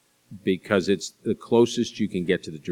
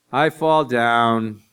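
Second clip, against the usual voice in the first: loud, slow, higher mock kid's cry; child calling out; 4 semitones higher, 7 dB louder, much slower than usual. Young daughter calling to parents from bed, calling out.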